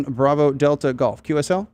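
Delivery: usual speaking voice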